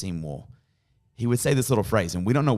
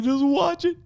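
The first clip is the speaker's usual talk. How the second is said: very high, louder crying plea; high-pitched retold wail; imitated tearful wife; 5 dB louder, slower than usual. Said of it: "my wife is crying on the floor".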